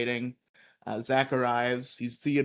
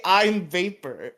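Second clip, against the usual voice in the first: slow mock villain; 5 semitones higher, 5 dB louder, slower than usual. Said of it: "why would he just say".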